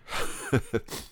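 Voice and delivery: quiet unvoiced chuckle; breathy laugh. Real, not acted. Preacher laughing at the nurse's comeback. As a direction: laugh at the joke.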